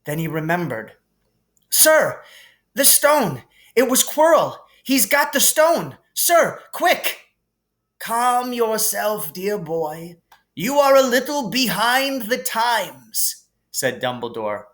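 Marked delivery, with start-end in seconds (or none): 1.7-7.2 put-on high, loud alarmed young voice; urgent boy's cry. Harry urgently warning Dumbledore, high loud alarmed cry. 8.0-13.5 higher, gently amused headmaster voice; kindly old headmaster. Acted. Dumbledore calming Harry in the hospital wing, gently amused.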